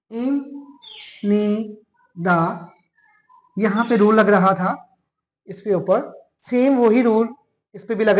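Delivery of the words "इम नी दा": इम नी दा with नी दा stretched very slowly; Korean word, slowed. Shown as a demonstration. Teacher to students, recalling the earlier Korean example slowly. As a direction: sound out इम नी दा syllable by syllable. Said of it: "इसका पढ़ते थे".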